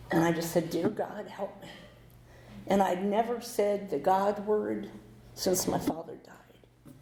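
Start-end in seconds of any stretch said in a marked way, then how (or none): 0.7-1.7 hushed, breaking plea to God; softer desperate prayer; barely voiced plea for help; quiet prayer.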